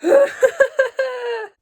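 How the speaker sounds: high laugh breaking into a cry; real laugh-cry; laughing sob. Real, not acted. Reader overcome by the book, laughing through tears.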